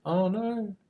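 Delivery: high-pitched, playful mock horror; mock dismay. Game master teasing fears of doing a bad accent, mock dismay.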